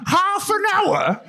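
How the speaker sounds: high, drawn-out incredulous fury; mock outrage. Mocking a friend appalled at the delivery wait, high mock outrage. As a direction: imitate him aghast, slow and high. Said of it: "and he's furious".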